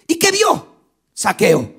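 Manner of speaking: impassioned sermon climax; fiery preaching. Acted. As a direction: proclaim it to the whole congregation. Preacher narrating miracle, full force.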